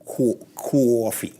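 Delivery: low, slow Boston drawl on coffee; practising a Boston "coffee"; Boston accent. Shown as a demonstration. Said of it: "Trying to say the word in the correct Boston ac".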